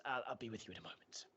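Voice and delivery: fast whispered aside; hurried whisper. Acted. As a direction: rush it under your breath.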